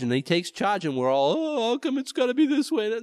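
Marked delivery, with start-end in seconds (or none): 1.4-3.0 high-pitched protest at God's plan; mock complaint, protesting. Believers grumbling once the Lord takes charge, mock complaint. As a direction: complain as if hard done by.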